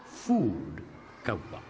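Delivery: usual speaking voice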